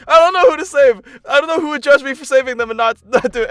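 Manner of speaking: high, loud panicked rambling; frantic can't-decide panic; panicked dithering. Retold or imitated. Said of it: "because I'm like".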